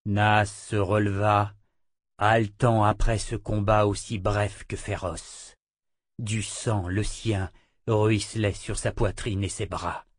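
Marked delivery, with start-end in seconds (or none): none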